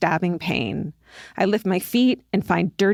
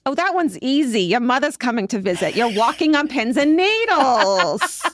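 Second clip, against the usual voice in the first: put-on Long Island accent; 4 semitones higher than usual. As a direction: play the mother-in-law with a Long Island accent. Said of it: "in her perfect Long Island accent".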